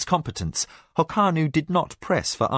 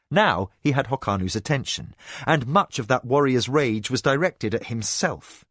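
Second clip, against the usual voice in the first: ordinary delivery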